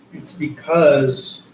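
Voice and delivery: very slow, weighted words; slow and deliberate. Real, not acted. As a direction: drag it out to make the point. Slow emphatic point in reading.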